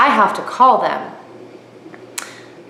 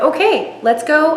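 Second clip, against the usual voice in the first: eager excitement; 8 semitones higher than usual. Retold or imitated. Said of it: "And I said".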